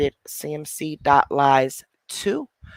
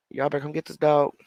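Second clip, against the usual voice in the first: ordinary talk, nothing marked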